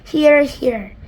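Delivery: loud cheering call; shouted. Acted. Creatures cheering the Ladybird, loud shout. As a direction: cheer agreement. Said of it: "they all shouted".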